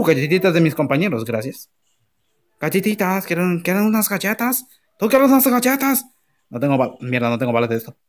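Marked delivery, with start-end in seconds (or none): 2.6-6.1 teasing sing-song voice. Pretend. Teasing teammates with cookies.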